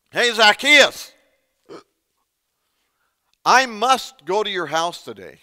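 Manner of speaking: calling out. Acted. Jesus singling out Zacchaeus in the tree, calling out loudly. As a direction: call up to someone in a tree.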